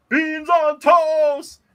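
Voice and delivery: drawn-out high yell of beans on toast; yelled call sign. Shown as a demonstration. Host yelling the channel call sign to fans, long and high. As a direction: belt it out slowly like a rallying cry. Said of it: "you gotta yell".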